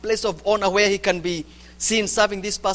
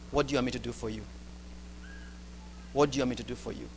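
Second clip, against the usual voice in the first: lower, much softer, patient question; gentle and soft; 9 semitones lower, 13 dB quieter, much faster than usual. Acted. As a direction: ask it gently, like a patient teacher. Jesus gently asking, set against the demanding disciples.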